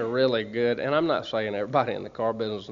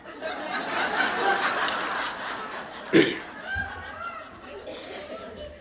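high-pitched laugh; 4 dB quieter than usual. Real, not acted. Laughs at the punchline.

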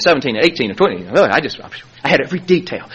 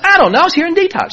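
high defensive protest; 13 semitones higher, faster than usual. Retold or imitated. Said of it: "I said".

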